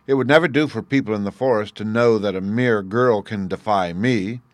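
loud, vengeful giant voice; angry giant. Acted. Giant tells his wife his plan, loud and vengeful. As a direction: vow revenge loudly, as a giant. Giant boasting angrily at supper.

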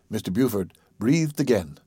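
ordinary delivery

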